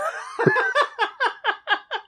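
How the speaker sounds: loud, high burst of laughter; cracking up. Real, not acted. Co-host losing it at the Willem Dafoe joke, loud high laughter. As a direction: laugh hard and long, high-pitched.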